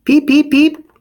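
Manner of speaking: very high loud peeps; peeper call. Acted. The peeper frog calling in the story, very high and loud. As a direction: chirp like a tiny frog.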